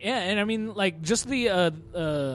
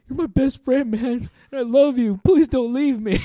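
mock tearful plea; 6 semitones higher, 6 dB louder than usual. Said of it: "He's like".

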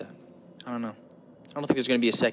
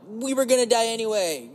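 raised pitch, shrugging it off; resigned inner voice; 9 semitones higher than usual. Retold or imitated. Part of him shrugs at dying, resigned. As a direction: toss it off like a joke. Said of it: "part of me is like".

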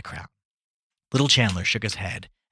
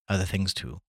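usual speaking voice

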